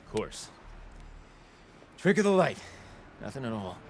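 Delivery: low, soft crestfallen murmur; disappointed. Acted. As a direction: swallow the letdown quietly. Disappointed about missing looking glass.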